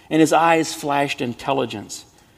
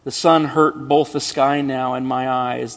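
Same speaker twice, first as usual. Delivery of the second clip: ordinary delivery, nothing marked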